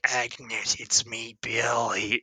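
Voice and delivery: hushed killer calling his victim; imitated creepy killer. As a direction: drop to a soft, eerie murmur. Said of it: "calling her Agnes".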